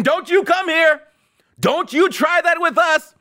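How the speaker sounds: high-pitched hammed-up scolding, little added volume; mock screaming. Host pretending to warn off a hurricane, mock screaming. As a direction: scold a storm in a high, strained mock yell. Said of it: "screaming at the hurricane".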